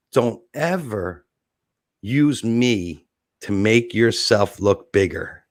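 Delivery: low, very slow warning. Retold or imitated. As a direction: imitate a star giving a measured, menacing reprimand. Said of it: "He was serious".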